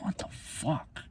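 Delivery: a stunned whisper; whispered disbelief. Real, not acted. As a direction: breathe it out in disbelief. Disbelief at Biden's remarks.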